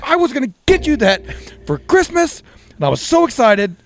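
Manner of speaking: high, loud retold wail of disappointment; worked-up protest. Husband tells wife his surprise was spoiled, high worked-up protest. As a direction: re-enact an exasperated, excited complaint. Retold outburst over a stolen gift.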